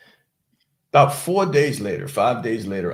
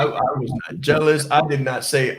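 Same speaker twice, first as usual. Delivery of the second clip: ordinary talk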